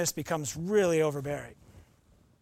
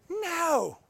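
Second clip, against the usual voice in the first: high, far put-on angry British no; hammed-up angry British refusal; angry baking-show no; 12 semitones higher, 4 dB louder than usual. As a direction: snap it like a cross baking-show judge. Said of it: "like the British baking show, but angry".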